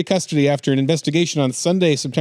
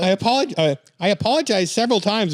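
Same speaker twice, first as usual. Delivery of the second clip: higher-pitched mock Canadian voice; attempted Canadian accent; 7 semitones higher than usual. Reader voicing the accused woman, in an attempted Canadian accent. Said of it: "I'm trying to do my, uh, Canadian voice".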